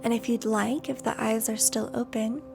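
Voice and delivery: unhurried meditation voice with long pauses; guided meditation. Acted. Yoga teacher settles the class into breathing, guided meditation voice.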